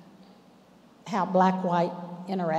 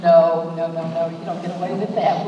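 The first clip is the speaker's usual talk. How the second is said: loud, firm no, fast on the last words; retold loud refusal; 12 dB louder than usual. Telling the police department off, loud and firm. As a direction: push back hard, like shutting them down.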